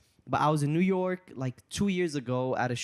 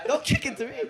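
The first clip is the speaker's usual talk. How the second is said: very high squeal through laughter; begging in real fright, laughing; panicked laughing shriek; 5 dB louder than usual. Real, not acted.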